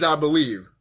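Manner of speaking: normal talking voice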